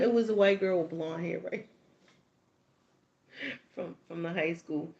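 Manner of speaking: ordinary talk, nothing marked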